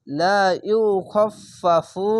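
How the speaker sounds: slow লা ইউখাফফাফু with a long লা; clear, measured recitation. Shown as a demonstration. Teacher showing practice reading, slow and clear. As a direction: stretch লা and press the doubled ফ in ইউখাফফাফু. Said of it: "সেরকমভাবে স্পষ্টভাবে".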